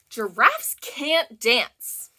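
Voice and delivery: ordinary talk, nothing marked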